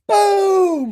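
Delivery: sudden long, high "boom"; imitated explosion, loud and high. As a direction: startle sleepers with a drawn-out shout. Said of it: "And I went".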